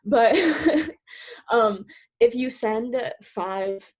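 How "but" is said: breathless laugh. Real, not acted. Laughing after admitting breathlessness.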